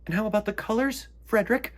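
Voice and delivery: high, fast, anxious mouse voices; anxious mice. Acted. Mice anxiously pressing Frederick, high and quick. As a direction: hurry the question, squeaky and worried. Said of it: "they asked anxiously".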